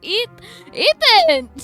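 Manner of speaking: high, loud cry of the name; scandalized shout. Real, not acted. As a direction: yell it, about to burst out laughing. Scolding viewer Ethan through laughter.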